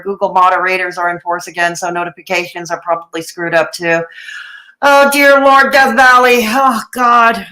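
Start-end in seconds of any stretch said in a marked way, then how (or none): 4.8-7.4 loud, laughing exasperation; exasperated outburst. Real, not acted. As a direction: throw your hands up, loud.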